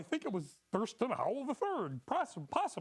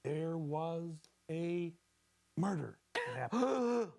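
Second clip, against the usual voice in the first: mysterious; 4 semitones lower than usual. Acted. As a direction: spin it like a ghost story. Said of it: "Incorporate mysterious elements".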